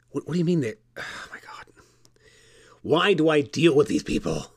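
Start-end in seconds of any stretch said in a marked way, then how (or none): 0.1-1.7 baffled whispered muttering; whispering. Acted. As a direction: whisper in disbelief. Baffled host muttering to himself.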